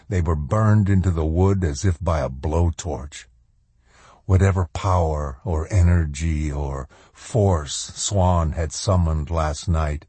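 ordinary delivery, nothing marked

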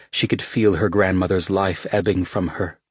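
ordinary delivery

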